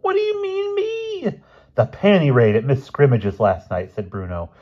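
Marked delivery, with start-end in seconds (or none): 0.0-1.4 very high, squeaky protest; indignant Elmer voice. Acted. Accused boy answering his friend, high-pitched indignant protest. 1.8-3.9 higher, loud accusing schoolboy; excited accusation. Acted. Boy accusing his friend about last night, loud and excited. Bruno accuses Elmer of a raid.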